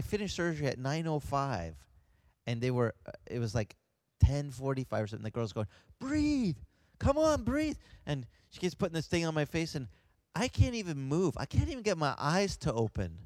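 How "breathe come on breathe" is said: imitated nurse's high-pitched urging; high, insistent mock command; urgent coaxing. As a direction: call out to rouse a patient. Nurse urging paralyzed patient to breathe.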